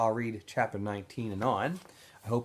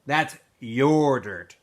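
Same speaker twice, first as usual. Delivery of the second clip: slow, heavy, louder bully line; menacing Zigzag; 6 dB louder, much slower than usual. Acted. Bully Zigzag to Stanley, slow and menacing. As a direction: drag it out to intimidate.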